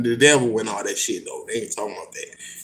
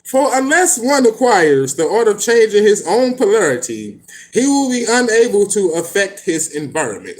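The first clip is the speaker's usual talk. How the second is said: higher, grander mocking recital; lofty, a bit louder send-up of the book's words; mock pompous reading; 7 semitones higher, 4 dB louder than usual. Shown as a demonstration. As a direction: lampoon the old-fashioned phrasing. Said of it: "why, why they talk like this?".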